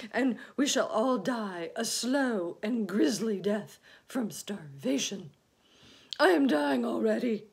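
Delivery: loud, high wail of doom; hammed-up dying lament; gloomy earthworm, melodramatic. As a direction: wallow loudly in misery, then sink at the end. Earthworm predicts slow death.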